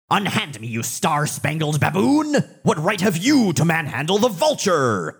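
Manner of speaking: put-on high, theatrical old villain bluster; hammy stage actor. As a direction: ham it up like a pompous old villain. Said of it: "everything this guy says is gold".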